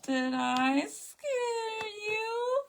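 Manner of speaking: high, slow, giggly teasing; teasing laughing. Pretend. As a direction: tease sweetly through laughter.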